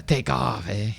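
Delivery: lightly voiced Canadian "Take off, eh?" ending on a tag eh; Canadian style. Shown as a demonstration. Showing viewers another Canadian eh at a sentence end, in Canadian accent. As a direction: toss it off like a laid-back Canadian. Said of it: "that's kind of Canadian style".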